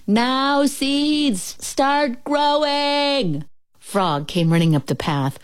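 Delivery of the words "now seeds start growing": high, very drawn-out sustained call; shouting. A narrator's reading. Toad yells right at the ground, shouting. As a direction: holler it slowly, holding each word high. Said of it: "and shouted".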